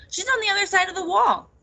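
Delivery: high, loud, eager delivery; excited news. A narrator's reading. Raccoon tells Nettle her cousin is near, excited.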